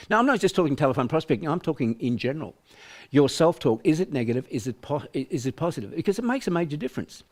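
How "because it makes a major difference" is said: normal talking voice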